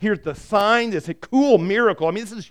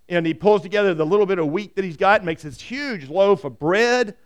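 normal talking voice

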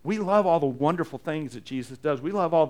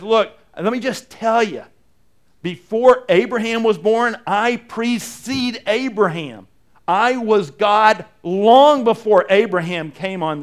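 louder, higher and slow; bold proclamation; 5 semitones higher, 7 dB louder, slower than usual. Acted. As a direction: proclaim it with full force. Jesus' bold claim preached.

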